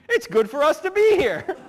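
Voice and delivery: hammed-up loud, high hail; acted eager call. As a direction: call out like an overexcited disciple. Voicing excitable apostle Peter.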